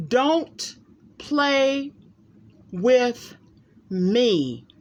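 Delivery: each word punched apart, slowly; emphatic warning. Acted. Warning doubters, each word hit separately. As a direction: lay down every word like a warning.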